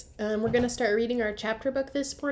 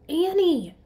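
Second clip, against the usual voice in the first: higher, louder call of her name; 9 semitones higher, 8 dB louder than usual. Acted. Jack calling up to his sister, loud call. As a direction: call up to the treehouse. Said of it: "Jack called".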